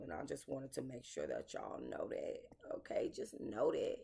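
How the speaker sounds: hushed whisper to the viewers; breathy whispered aside. Real, not acted. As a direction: drop to an unvoiced whisper, letting them in on it.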